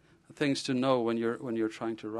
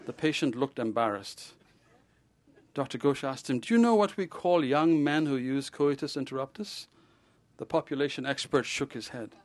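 lively read-aloud of a novel, a little higher; story reading; 4 semitones higher, 4 dB louder than usual. A narrator's reading. Author reading his novel aloud to a crowd, lively storytelling. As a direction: read your novel to the audience with relish.

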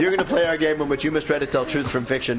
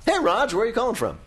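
much higher, upbeat hello; high cheery greeting; 9 semitones higher than usual. Real, not acted. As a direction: greet the caller with a lift. Host greets caller.

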